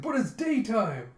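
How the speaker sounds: high, loud, drawn-out objection; mock protest. Boy protesting daytime drinking, mock outrage. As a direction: whine a scandalized objection.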